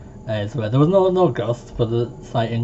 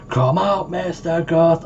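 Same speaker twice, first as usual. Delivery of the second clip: calling ghost; 7 semitones higher, 3 dB louder, slower than usual. Pretend.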